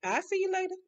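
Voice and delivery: high, airy send-off; mock unbothered. Gloria sees off her departing lover, unbothered. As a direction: wave him off in a high, unworried voice. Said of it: "Gloria wasn't even sweating".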